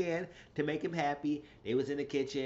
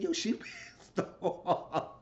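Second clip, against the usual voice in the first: slow, laughing through the words; 6 semitones higher, slower than usual. Real, not acted. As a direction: let a laugh break into the line. Gossiping, repeating the verdict.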